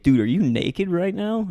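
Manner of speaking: loud, high-pitched astonished question; startled disbelief. Retold or imitated. Friend spotting him walking past naked, astonished. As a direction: blurt it out in amazement.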